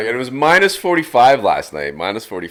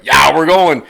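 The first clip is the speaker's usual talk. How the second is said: louder, higher eager yes; got hyped; 6 semitones higher, 11 dB louder, slower than usual. Retold or imitated. Narrator quoting himself getting sold on the trip, loud and eager. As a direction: agree with rising excitement. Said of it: "he got me hyped".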